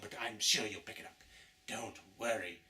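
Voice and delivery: usual speaking voice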